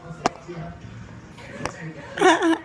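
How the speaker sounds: breathy giggling from being tickled; ticklish laugh. Real, not acted. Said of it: "You're tickling me".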